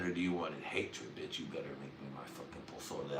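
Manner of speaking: soft, fast mock menace; half-whispered threat. Real, not acted. Joking at his wife who's watching, softly and fast. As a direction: hiss it at her teasingly. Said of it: "I know you're watching".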